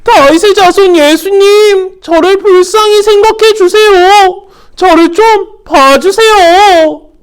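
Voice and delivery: high, loud, slow desperate cry. Acted. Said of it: "더 큰 소리로 이야기했어요".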